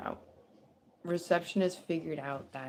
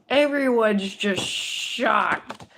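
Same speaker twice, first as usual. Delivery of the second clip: slow, much louder and higher second take; drawn-out loud shock; 5 semitones higher, 12 dB louder, much slower than usual. Shown as a demonstration. Reader repeating the line, louder and slower, to stress the shock. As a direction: stretch it out, stunned and loud.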